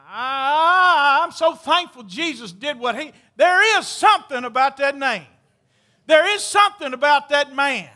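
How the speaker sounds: fervent altar-call preaching; full-force preaching. Acted. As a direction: preach it out to the congregation.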